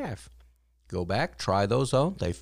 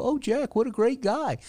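high-pitched imitated gushing; mock admiring listener; 13 semitones higher than usual. Imagined listener praising the storyteller, mock admiring. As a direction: gush in a lifted, put-on voice. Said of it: "so you can go".

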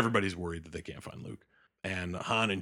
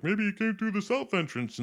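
helpful rebel; 12 semitones higher than usual. Retold or imitated. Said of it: "and they're like".

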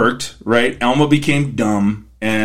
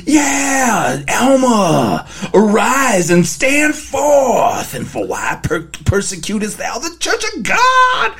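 hammy booming angel; 8 semitones higher, slower than usual. Pretend. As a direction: proclaim it like a rock star angel.

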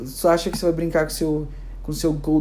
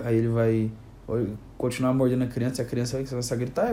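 ordinary talk

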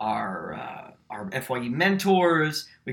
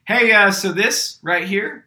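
louder, higher and slow, like presenting a friend; confident announcing; 7 semitones higher, 8 dB louder, slower than usual. Retold or imitated. Classmate presenting his partner to the room, loud and slow. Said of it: "Colin stands up and goes".

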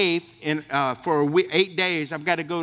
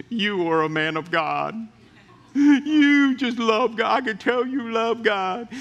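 put-on old lady at the clinic blessing the pastor, slow and swelling; elderly church lady; 3 semitones higher, slower than usual. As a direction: play the moved old woman marveling at the pastor.

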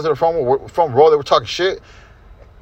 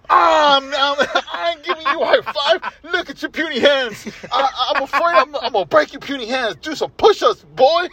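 higher, hammed-up sneer at a kid; mocking heel voice; 9 semitones higher than usual. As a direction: taunt the boy like a cartoon bully.

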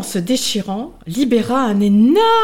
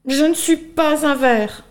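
timid protest; 6 semitones higher than usual. Acted. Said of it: "rétorqua la chenille".

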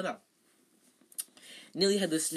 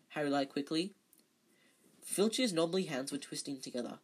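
usual speaking voice

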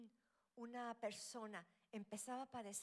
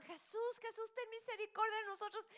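much higher, loud pleading cry; desperate shout; 11 semitones higher, 6 dB louder than usual. Retold or imitated. Ten lepers crying to Jesus, loud desperate shout. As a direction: scream it to Jesus, begging for mercy. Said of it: "pero le gritaban".

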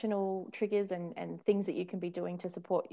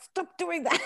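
higher, louder fed-up command; exasperated order; 10 semitones higher, 4 dB louder, slower than usual. Retold or imitated. Mother telling her whinging child to stop, exasperated. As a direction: snap it like a tired parent.

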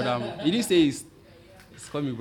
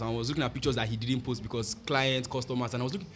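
usual speaking voice

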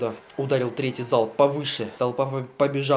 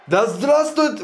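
high, loud exultant cry; rallying cry; 9 semitones higher, 6 dB louder, much slower than usual. Acted. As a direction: cheer it out defiantly. Rallying cry before volley.

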